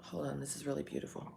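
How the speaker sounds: quick hushed aside, voice dropped to a whisper. Real, not acted. Whispering to the camera over something beautiful. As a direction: whisper it fast so no one hears.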